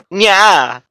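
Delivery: high cutesy cat voice with a nya. Acted. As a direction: play it cute like a catgirl. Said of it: "Raku-chan asked cutely and cat-like".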